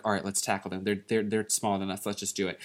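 low hushed plotting voice. Acted. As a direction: whisper the plan quietly. Conspiratorial kids plotting.

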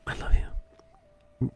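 slow, whispered I love you; intimate whisper. Shown as a demonstration. Showing how inflection changes love's meaning, whispered slowly. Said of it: "Depending how we use the inflection".

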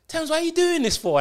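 put-on high, whining little-boy plea; plaintive plea. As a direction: beg like a beaten kid. Voicing the friend pleading on floor.

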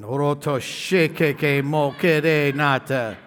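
slow, drawn-out tongues; praying in tongues. Shown as a demonstration. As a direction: pray it slowly in tongues. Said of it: "I literally pray in tongues".

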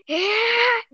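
high mock cry of anger; imitated mad noise. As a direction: let out a wordless, high angry cry. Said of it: "really mad".

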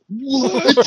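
high, loud disbelieving yelp; shocked outburst. Retold or imitated. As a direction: re-enact a stunned cry of disbelief. Retells shocked reaction to the revelation.

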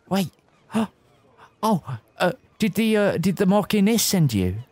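lightly voiced nervous Kiwi young man; a hint of a New Zealand accent, unsure. GM as Gavin meeting strangers, in a light Kiwi accent. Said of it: "that accent has gone".

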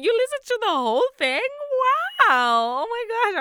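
high, loud, astonished gush; amazed. Retold or imitated. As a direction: squeal your disbelief. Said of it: "I was like".

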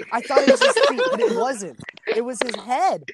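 high laughing disbelief; giggling through the punchline. Real, not acted. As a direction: crack up while telling it. Laughing retelling the head.

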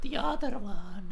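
slow, high mock echo of the friend; echoing Marta. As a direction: repeat her line slowly in a high voice. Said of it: "Marta will say".

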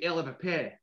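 Dundee accent, "I'll have a pie" as "Ell have a peh". Shown as a demonstration. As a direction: Dundee Scots accent, clipped vowels. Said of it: "but they'll say".

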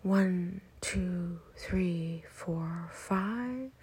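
inhale count. Shown as a demonstration. Guide counting five seconds of breathing in, count with final lift. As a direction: count evenly, rising at the top of the breath.

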